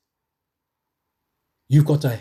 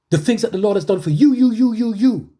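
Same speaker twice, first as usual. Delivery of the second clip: louder, higher, faster, driving home you; emphatic preaching; 7 semitones higher, 5 dB louder than usual. Real, not acted. Preacher tells the brothers and sisters the Lord acted for them, fervent. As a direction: point at the listeners with each you. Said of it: "emphatic repetition".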